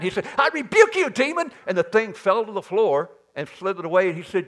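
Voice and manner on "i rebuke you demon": high, loud commanding rebuke; angry rebuke. Retold or imitated. Hagin, fed up, rebuking the chattering demon, loud and angry. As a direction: cast the demon out with force. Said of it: "He was getting mad".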